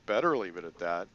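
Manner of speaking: loud, low gruff grunt of a line; gruff cop. Acted. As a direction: growl it like a tough cop. Wu lets out a grunt.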